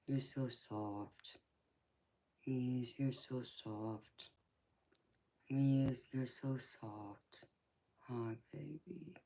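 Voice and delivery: slow hushed cooing. Real, not acted. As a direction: coo slowly and softly. Cooing softly to baby.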